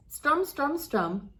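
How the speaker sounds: ordinary delivery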